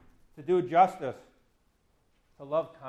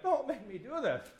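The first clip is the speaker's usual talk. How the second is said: high, whiny mock plea; hammed-up begging to be let off; mock pleading; 8 semitones higher than usual. Pastor imagining a crying Jesus, mock pleading.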